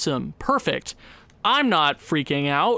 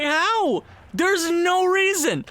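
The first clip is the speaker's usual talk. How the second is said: high, pleading disbelief; exasperated disbelief; 11 semitones higher, slower than usual. Real, not acted. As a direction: ask the universe why. Despair over team.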